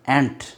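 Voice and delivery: ain't in one syllable, "ent"; recommended pronunciation. Shown as a demonstration. English teacher repeating the model, one-syllable ain't. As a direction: say ain't as one short beat. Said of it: "pronunciation होता है इसका".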